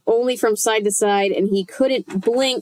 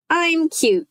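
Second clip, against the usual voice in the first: high squeaky puppet voice; squeaky dummy voice; 8 semitones higher than usual. A narrator's reading. Slappy bragging to Chris in a high squeak. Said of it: "she made him say".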